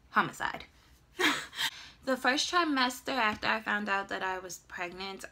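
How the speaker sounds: usual speaking voice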